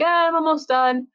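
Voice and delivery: high, loud chirp of a quote; Momiji. Retold or imitated. Answering about homework, in a high cheery voice. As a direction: chime in, pitched well up.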